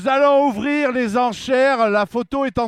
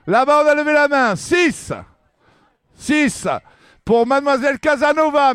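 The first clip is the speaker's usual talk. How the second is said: auctioneer; 4 semitones higher, 3 dB louder than usual. Acted. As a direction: call the bids out to the room. Auction calling out bids.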